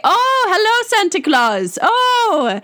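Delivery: high-pitched delighted Irish phone voice; Father O'Reilly. Retold or imitated. Priest pretending to take Santa's call before children, high delighted Irish accent. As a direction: imitate an Irish priest thrilled by the caller, swooping up high on each "oh". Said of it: "kind of an Irish accent".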